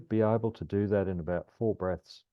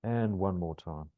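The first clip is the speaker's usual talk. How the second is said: calm grounding exercise. Acted. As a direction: ease them gently through the breath. Guides a grounding meditation.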